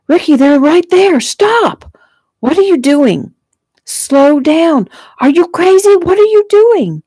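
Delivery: very high, loud frantic shouts; panicked yelling. A narrator's reading. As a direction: shriek at the driver to stop. Panicked girl begs brother to stop.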